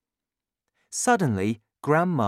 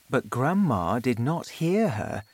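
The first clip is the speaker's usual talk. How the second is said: ordinary delivery, nothing marked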